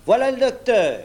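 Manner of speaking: high, ringing announcement shout; clear, vigorous hail; calling out. A narrator's reading. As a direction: call it across to the fort. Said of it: "criait-on".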